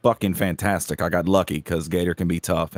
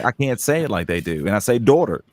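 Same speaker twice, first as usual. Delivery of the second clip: ordinary delivery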